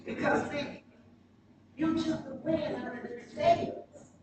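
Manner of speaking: ordinary talk, nothing marked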